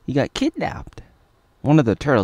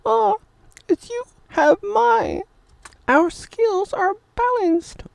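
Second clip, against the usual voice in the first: hammed-up high woman's voice; mock woman's voice; slower than usual. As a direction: squeak it like a silly fake lady. Dubbing woman character.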